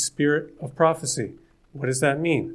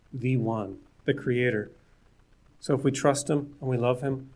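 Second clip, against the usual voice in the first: ordinary delivery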